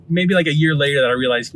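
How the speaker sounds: normal talking voice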